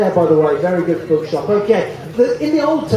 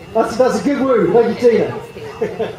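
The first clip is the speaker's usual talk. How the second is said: ordinary talk, nothing marked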